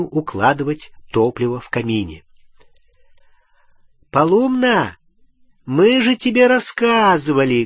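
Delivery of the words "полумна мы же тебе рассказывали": loud, high call across a room; yelling across the room. Acted. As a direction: call out loudly to someone far off. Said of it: "крикнула через всю комнату Гермиона".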